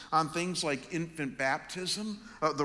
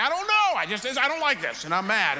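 much higher, much louder exasperated cry; frustrated outburst; 7 semitones higher, 8 dB louder than usual. Retold or imitated. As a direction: blurt it out, fed up and flustered. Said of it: "I'm like".

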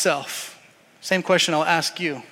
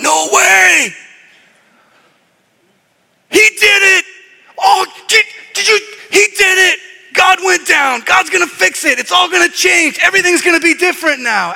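much higher, shouted celebration; ecstatic angels; 11 semitones higher, 7 dB louder than usual. Acted. Angels celebrating that God went down, shouting with joy. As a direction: shout it out, overjoyed. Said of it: "I think we got the angels going".

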